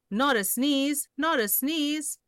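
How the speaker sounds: high, buzzy bee voice; buzzing bees. Acted. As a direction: buzz it like bees. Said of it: "buzzed the bees".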